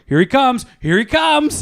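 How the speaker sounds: high, eager here-he-comes; excited calling out. Retold or imitated. Fans at the bench calling excitedly. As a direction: call it out like fans spotting him. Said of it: "because we're like".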